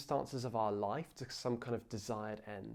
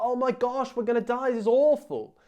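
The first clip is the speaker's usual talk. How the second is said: higher, louder, hammed-up panic; mock panic; 10 semitones higher, 10 dB louder than usual. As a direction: play the frantic disciples for laughs. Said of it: "It's like".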